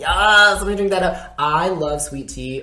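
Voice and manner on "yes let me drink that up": loud, eager yes; greedy gulp of delight; loud, delighted mock craving; excited. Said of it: "And I'm like".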